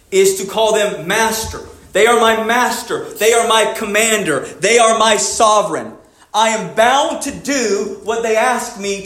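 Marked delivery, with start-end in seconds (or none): none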